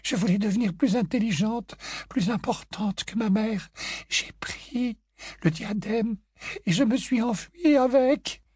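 high, hushed lady ghost, dropping to a whisper; ghostly confession. A narrator's reading. Grey Lady confessing her past to Harry, hushed. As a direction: confide the old shame.

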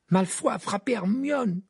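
high-pitched, urgent report; urgent protest. A narrator's reading. Ron tells Rogue about Hermione, urgent.

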